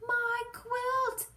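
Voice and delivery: very high alarmed cry. Acted. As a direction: yelp as the quilt is snatched. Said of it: "cries Quincy".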